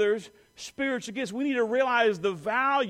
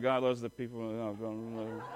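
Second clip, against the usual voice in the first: low, grudging and joyless; mock reluctant; 10 semitones lower, 5 dB quieter than usual. Preacher mimicking reluctant churchgoers, grudging and low. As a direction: mumble it like you don't mean it. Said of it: "Some of you are like".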